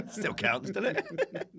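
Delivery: amused, giggly tag question; laughing self-defence. Real, not acted. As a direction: plead your case while laughing. Laughing at own small credit.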